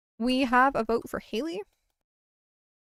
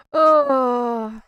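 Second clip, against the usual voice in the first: startled cry; 13 dB louder than usual. Real, not acted.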